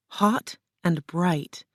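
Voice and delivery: ordinary delivery, nothing marked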